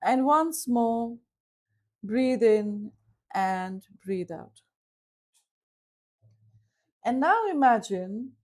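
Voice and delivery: slow guided breathing, long pauses; guided visualization voice, slow on the breaths; guided meditation. Acted. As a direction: lead them gently through the breath. Leads a visualization.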